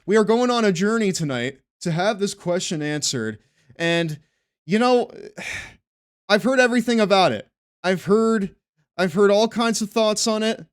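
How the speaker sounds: emphatic host voice, lightly done; show host intro. Acted. Hosts a mock show intro.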